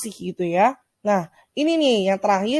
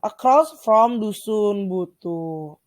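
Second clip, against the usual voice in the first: slow model reading; much slower than usual. Shown as a demonstration. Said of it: "teacher models English phrase".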